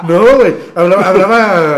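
bursts out laughing, loud and high; cracking up mid-sentence; real loud laughter. Real, not acted. Laughing fit at the story.

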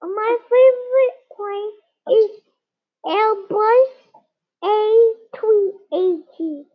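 jaguar pet voice. Acted. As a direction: play a cute little jaguar. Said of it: "this is what she sounds like".

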